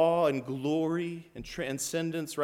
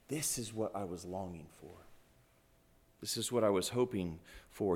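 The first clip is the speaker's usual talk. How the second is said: low, very soft wonder; hushed realization; 6 semitones lower, 8 dB quieter, faster than usual. Acted. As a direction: let it out almost under the breath. Voices disciples' quiet realization.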